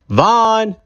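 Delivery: high, calling his son's name; father calling his son to come down; exclaiming. Acted. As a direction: call up the stairs. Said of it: "exclaimed his father".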